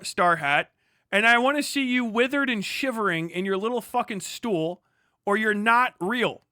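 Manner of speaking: ordinary talk, nothing marked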